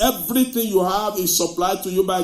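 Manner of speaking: loud, driving sermon voice; full-force preaching. Real, not acted. Pastor rousing the congregation, loud preaching. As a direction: preach it out to the back row.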